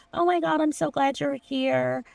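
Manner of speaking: ordinary delivery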